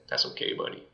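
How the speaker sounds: calm him down quietly; soft reassurance. Retold or imitated. Father reassuring his son.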